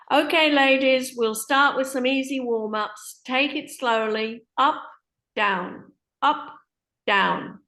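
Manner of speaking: loud, bright class-leader calls; instructor over PA. Acted. PA instructor leading ladies' warm-ups, loud calls.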